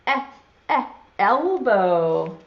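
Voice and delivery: short "eh" twice, then a slow, drawn-out "elbow"; letter E sound. Shown as a demonstration. Teacher prompting children to guess the picture, sounding out "eh, eh, elbow". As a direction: sound out the first vowel of "elbow" as separate "eh"s. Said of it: "makes sort of a funny sound".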